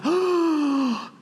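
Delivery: a high, breathy oh of wonder; surprised oh. Retold or imitated. Retelling the moment he first sees her, awed oh. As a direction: let the oh leap up in awe. Seeing the love of his life.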